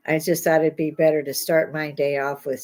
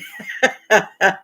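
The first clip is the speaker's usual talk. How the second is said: short laugh at her own joke; bright laugh; 4 dB louder than usual. Real, not acted. Vlogger laughing at her own joke, bright laugh. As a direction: laugh it off lightly.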